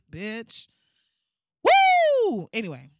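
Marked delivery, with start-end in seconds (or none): 1.6-2.5 high, loud whoop; excited whoop. Real, not acted. Cracking herself up on camera, loud high whoop. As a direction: let out a loud woo. Exclaims after laughing.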